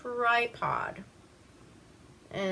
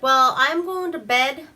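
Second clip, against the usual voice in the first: husband announcing; 6 semitones higher, 8 dB louder than usual. Retold or imitated. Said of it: "he's like".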